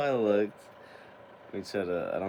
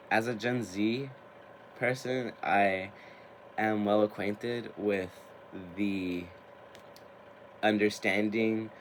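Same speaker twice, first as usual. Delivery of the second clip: normal talking voice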